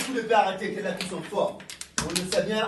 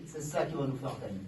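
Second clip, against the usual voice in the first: usual speaking voice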